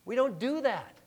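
usual speaking voice

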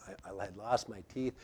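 ordinary talk

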